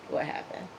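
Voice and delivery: whispered, hushed question; same question, now whispered. Shown as a demonstration. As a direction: breathe it out like gossip. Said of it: "asks twice, second whispered".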